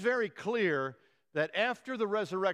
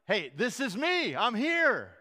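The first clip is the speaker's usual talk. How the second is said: slow, higher and louder, eager; excited reassurance; 5 semitones higher, 5 dB louder, slower than usual. Retold or imitated. Jesus reveals himself to Mary, excited and eager. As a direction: announce yourself with joy. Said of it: "It, it was an excited statement".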